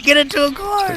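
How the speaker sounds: squeaky high mock shout; high mock coach call. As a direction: yelp it way up high, jokingly. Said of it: "I probably would be like".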